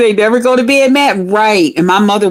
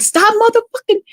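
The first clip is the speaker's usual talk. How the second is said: very high mock exasperation; exasperated outburst. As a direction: burst out at a boyfriend who keeps following, pitched way up. Exasperated with boyfriend following her.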